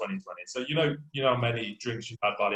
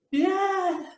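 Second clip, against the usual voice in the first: much higher, squeaky yell; mock kid cheer. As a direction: imitate a kid whooping at his team. Mimicking kid cheering a four.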